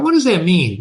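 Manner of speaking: ordinary delivery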